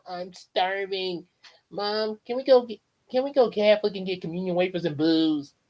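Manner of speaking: lightly voiced cheeky kid; Bart Simpson voice. Bart begging Mom on the drive home, cheeky. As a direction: whine it like a bratty boy. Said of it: "Bart Simpson was like".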